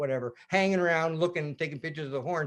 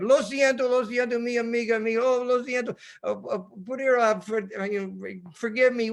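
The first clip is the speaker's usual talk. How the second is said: flustered Spanglish; 5 semitones higher than usual. Retold or imitated. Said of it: "I was a little excited".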